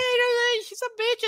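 high breezy throwaway "she's a bitch"; mock casual. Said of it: "she just said it so casually".

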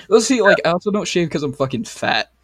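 ordinary talk

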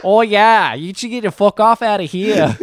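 loud, high American caricature; put-on American accent. Shown as a demonstration. Bragging to co-host about the accent, loud mock American accent. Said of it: "It's the best American accent".

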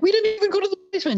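very high, rapid mock protest; panicked denial. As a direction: blurt it out high and fast like a caught kid. Boys hastily deny to supervisor.

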